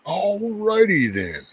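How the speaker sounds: exaggerated alrighty then. Shown as a demonstration. To the viewers after the song, a high, slow mock catchphrase. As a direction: stretch it up high like a cartoon catchphrase.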